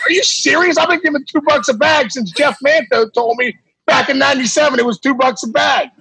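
can't believe he's been tipping wrong for years; amazed disbelief. Retold or imitated. Ballplayer learning the real tip, high incredulous protest.

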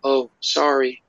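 slow ghostly woman's reply, lightly voiced. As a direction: imitate a woman's slow, high apology. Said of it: "You hear a woman's voice go".

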